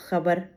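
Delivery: खबर opening on a throaty fricative kh; teacher demonstrating the dotted kh in खबर; nukta pronunciation. Shown as a demonstration. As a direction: scrape the first sound of खबर in the throat. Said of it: "इनके उच्चारण में फ़र्क हो जाता है".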